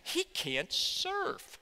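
slow, drawn-out mock pity; imagined mockery. Imagined onlooker mocking Carl, slow mock pity. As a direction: stretch it like a smug onlooker. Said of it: "was in bed going".

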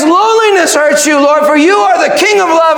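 high, impassioned answer to the Lord; crying out. Acted. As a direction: cry it out with fervour. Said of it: "And she cries".

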